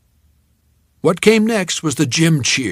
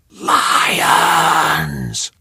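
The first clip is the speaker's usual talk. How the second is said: loud, high roar of a name; crowd shout; 3 semitones higher, 4 dB louder than usual. Acted. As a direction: bellow it back from the bleachers.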